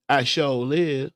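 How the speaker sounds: hammed-up Southern US accent. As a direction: lay on the Southern accent, slow. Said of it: "In my most southern voice".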